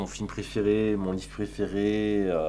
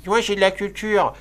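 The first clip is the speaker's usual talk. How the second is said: voix aiguë et forte de frimeur cultivé; imitation d'Arnaud; 8 semitones higher, 6 dB louder than usual. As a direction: singer le snob qui se vante de sa culture. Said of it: "Arnaud, toi qui". (moquer Arnaud en imitant sa vantardise)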